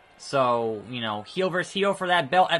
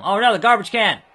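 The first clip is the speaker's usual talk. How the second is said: higher, louder cry; alarmed shout; 7 semitones higher, 8 dB louder than usual. Real, not acted. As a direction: shout in dismay. Alarmed at garbage can hit.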